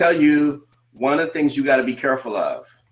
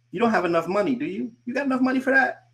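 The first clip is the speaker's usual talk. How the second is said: high, fast, scoffing put-on voice; mocking doubter; 9 semitones higher, faster than usual. Coach mimics a doubter scoffing at his money, high and mocking. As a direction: sneer the question like a doubter would.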